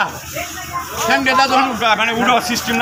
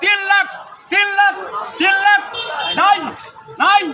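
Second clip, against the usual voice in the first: auctioneer; 5 semitones higher, slower than usual. Acted. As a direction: call out the bids like an auctioneer. Auctioneer repeats bid.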